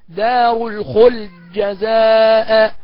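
very slow chanted دار الخلد جزاء with the د merged into the ج; full merging. Shown as a demonstration. Teacher reciting the full-merge reading. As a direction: draw it out, swallowing the dal. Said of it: "تاني".

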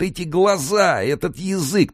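very high, plaintive wail; horrified moan. A narrator's reading. Cellmate staring at dead face, moaning in horror. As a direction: wail at the dreadful sight. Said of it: "простонал тот".

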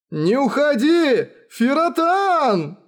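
high, long-drawn calling out; pleading, high-pitched wail of a name; desperate cry. Acted. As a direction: cry after someone leaving, stretching the words. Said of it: "Вдруг закричал Матаясу".

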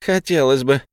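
sarcastic. A narrator's reading. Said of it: "Фея едко хмыкнула".

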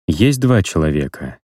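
usual speaking voice

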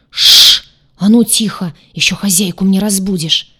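громкое «шш», затем резкий приказ замолчать; шикни на незваную гостью; шикает. Acted. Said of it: "шикнул на непрошенную гостью". (шиканье, строгое одёргивание)

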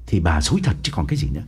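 fast, firm retort; insisting. Acted. Husband insists to his wife, firm and fast. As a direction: press the point hard. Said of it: "Ông Đường nhấn mạnh".

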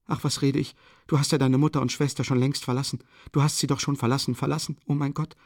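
high, fast, agitated outpouring; distraught cry. A narrator's reading. Sonja fearing for his mother and sister, distraught. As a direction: rush it out in distress, pitched high. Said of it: "schrie sie auf".

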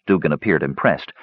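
usual speaking voice

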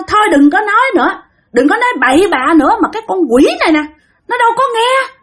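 high-pitched possessed rant; strained, insistent spirit. Retold or imitated. Spirit complains to the man about the girl, high and insistent. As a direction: press each point in a high spirit voice. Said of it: "mà nói găng".

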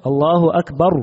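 "أكبر" ending in "رُ", "الله أكبرُ" with a final u on the r; raa with damma. Shown as a demonstration. Teacher showing the allowed damma on the final raa. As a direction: close "أكبر" with a short u on the r, not a stop. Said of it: "لو ضم الراء من كلمة أكبر".

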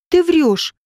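mild cry of accusation. A narrator's reading. Женька accusing Родион of lying, mild outcry. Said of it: "закричала Женька".

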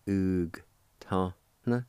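"Oog ta. na" in lightly separated syllables; careful teaching take of "Oog ta. na"; measured syllable demonstration. Shown as a demonstration. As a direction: say "Oog ta. na" with short gaps. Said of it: "pronounce the G sound with the U like this".

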